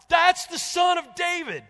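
very high, slow, full-throated shout. Retold or imitated. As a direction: top of the build, drowning out the crowd. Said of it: "shouting it more and more".

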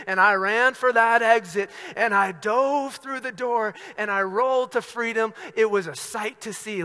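high-energy pulpit retelling; impassioned preaching. Retold or imitated. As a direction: preach the escape at full force.

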